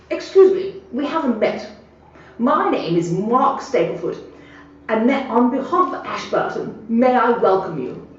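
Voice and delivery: put-on high, slow, plummy old gentleman voice; hammed-up posh old man greeting a newcomer. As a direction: lay on the posh welcome like a retired squire.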